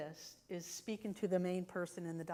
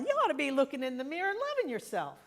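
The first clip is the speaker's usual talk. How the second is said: fast, loud, higher, animated quote; lively quoted mentor; 6 semitones higher, 7 dB louder, faster than usual. Retold or imitated. Retelling a mentor's advice from 1938, loud and fast. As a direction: rattle it off like an excited coach. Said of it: "he's going".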